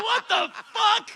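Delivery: very high, loud, drawn-out outburst; hammed-up disbelief. Promoter reacting to the opening act's ticket request, shouted mock disbelief. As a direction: blow up the promoter's shock, laughing. Retold shocked reaction, bigger repeat.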